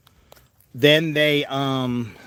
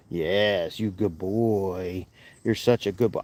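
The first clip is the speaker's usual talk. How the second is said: soft pet talk; 9 semitones lower, 7 dB quieter than usual. Real, not acted. Cooing to a pet animal.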